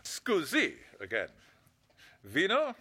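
cheesy put-on Italian; Italian accent. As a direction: play the Italian waiter. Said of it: "that phony Italian accent".

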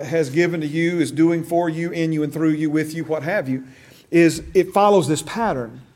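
ordinary delivery

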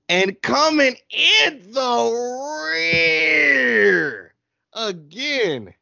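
very slow, high wailing drawl; mock mournful announcer. Host teasing Austin for last place again, mock wail. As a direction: stretch out last place like a lament. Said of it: "Do we have to put so much emphasis on that?".

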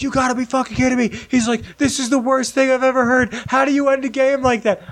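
high, indignant complaint; a fooled fan crying foul at the ending; outraged disbelief. Retold or imitated. Bus friend rants about the fake ending, high and outraged.

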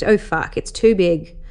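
plain reading voice

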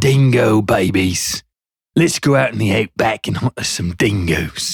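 a hammed-up Australian outback hunter; Australian accent. Trucker showing off a joke Australian accent to the co-host. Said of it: "I did one on my YouTube episode".

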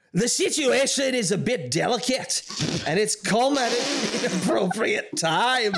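put-on light Scottish accent as a queen; a hint of a Scottish queen. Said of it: "Queen Cressida has a Scottish accent".

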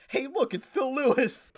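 high, drawn-out mock excitement; high-pitched gushing title; excited show title. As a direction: announce it like a thrilling discovery.

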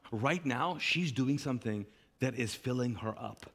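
soft, low, reassuring; calm a stressed friend kindly; Jesus gently reassuring Martha; gentle Jesus. Retold or imitated. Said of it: "Jesus is saying".

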